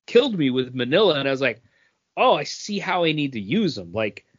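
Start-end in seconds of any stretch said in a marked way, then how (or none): none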